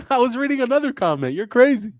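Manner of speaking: high-pitched, laughing denial; amused protest. Real, not acted. Comedian teased about reading a comment, protesting in amusement. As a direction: deny it in a high, loud squeal.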